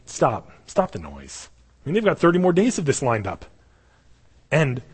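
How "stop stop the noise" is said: "Stop, stop the noise" slow, low and steady; the epitome of calm, hushing a crowd; calm, low command. Retold or imitated. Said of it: "you're telling them".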